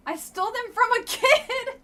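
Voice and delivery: cheeky squeal of a confession; excited. Pretend. Playful confession of the stolen tickets.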